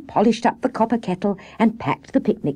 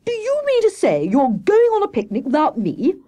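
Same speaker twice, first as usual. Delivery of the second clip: high-pitched outraged disbelief; affronted, hammed-up hare; indignant; 12 semitones higher, 4 dB louder than usual. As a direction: protest theatrically at being left out. Hare outraged at being left out.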